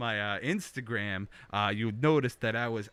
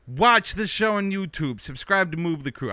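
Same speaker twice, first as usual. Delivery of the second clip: playful loud plug; 6 semitones higher, 8 dB louder than usual. Acted.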